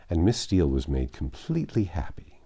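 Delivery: ordinary delivery, nothing marked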